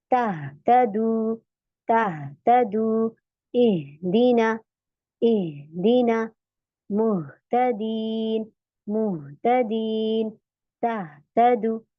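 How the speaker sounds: तह तदू and इह दीना stretched out very slowly; slow recitation drill. Shown as a demonstration. Teacher reciting the practice words slowly for students. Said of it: "तो सीना vibrate हो रहा है".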